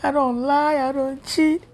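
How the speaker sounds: retelling how she sobbed out her questions to God; crying to God. Retold or imitated. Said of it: "I went to God and I cried".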